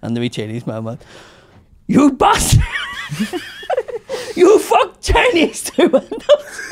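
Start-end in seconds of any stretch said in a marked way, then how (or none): 1.9-3.4 high, loud furious yell with laughter breaking in; shouted mock rant, laughing; angry Chinese boss. Boss yelling at driver. 4.4-6.6 high, loud furious yell with laughter breaking in; shouted mock rant, laughing; angry Chinese boss. Boss quote, first take.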